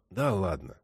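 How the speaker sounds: quiet weary concession; sighing. A narrator's reading. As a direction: let it go with a sigh. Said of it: "вздохнул Василий Павлович".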